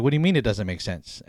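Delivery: usual speaking voice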